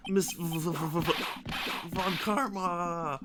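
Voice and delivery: slow, halting stammer into a long name. Acted. Character struggling to name Miss Von Karma, stammering. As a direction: stutter on the V, then draw out the name.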